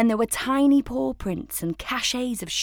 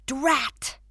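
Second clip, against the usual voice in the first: higher, annoyed little cry; frustrated exclamation; 5 semitones higher than usual. Acted. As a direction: curse under a sigh. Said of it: "she cried".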